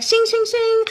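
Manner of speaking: high mock "shing" of a drawn blade; sword sound effect. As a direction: sing out the sword noise, playful. Mimicking a sword being drawn.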